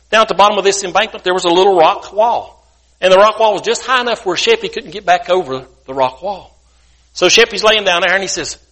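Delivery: ordinary delivery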